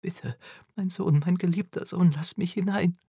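high, urgent entreaty; pleading. A narrator's reading. Mother at the barred door begging her son, pleading.

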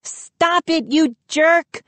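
high-pitched scream at moderate volume; angry scream. A narrator's reading. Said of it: "Mindy screamed".

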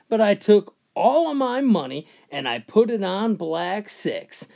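ordinary talk, nothing marked

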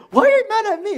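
very high, loud retold complaint; indignant protest. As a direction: play the wronged son protesting loudly. Retold quarrel with parents.

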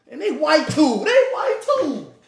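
high, loud and laughing; laughing disbelief. Real, not acted. Storyteller cracking up at his friend's insult, real high laughing voice. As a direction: crack up on the words, pitch climbing.

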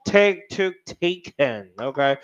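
very slow, deliberate verb forms, each word drawn out; slow drill. Shown as a demonstration. Teacher drilling irregular past tense, very slowly. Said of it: "刚刚都白念的".

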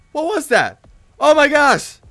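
high, loud shock; startled outcry. Real, not acted. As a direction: cry out as if hit from nowhere. Startled by being run over.

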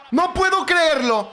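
slow, high shout of disbelief; disbelieving shout. Real, not acted. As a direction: yell that you can't believe it. Gol del Barcelona. (grito incrédulo)

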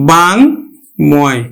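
বাংময় stretched very slowly; careful slow model of বাংময়; slow teaching demo. Shown as a demonstration. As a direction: draw out বাংময় so the ম is heard. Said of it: "আমরা উচ্চারণ করব কিন্তু".

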